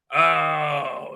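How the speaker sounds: usual speaking voice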